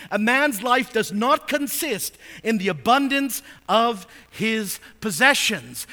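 verse proclaimed loud and high, slowing at the end; full-force preaching. Acted. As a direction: thunder the warning from the pulpit.

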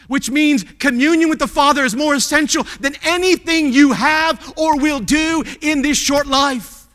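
high, loud pulpit fervour; loud sermon voice, slowing to hammer the end; impassioned preaching. Real, not acted. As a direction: proclaim it with full conviction. Preaches main point at full force.

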